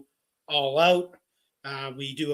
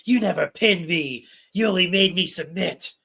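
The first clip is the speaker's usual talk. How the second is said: Trish impression; 4 semitones higher, 5 dB louder than usual. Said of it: "Trish comes back and goes".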